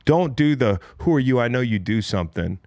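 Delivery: normal talking voice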